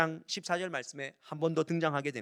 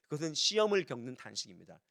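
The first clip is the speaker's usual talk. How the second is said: ordinary delivery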